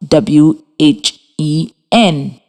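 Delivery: slow letter-by-letter spelling; dictating the letters slowly; spelled out. Shown as a demonstration. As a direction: read each letter W H E N separately. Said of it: "it is spelled".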